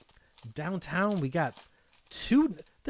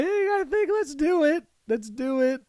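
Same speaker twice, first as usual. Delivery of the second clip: pitched way up, eager; psyched up to open it; high, louder thrilled urging; excited; 11 semitones higher, 4 dB louder than usual. Real, not acted. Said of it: "This is exciting".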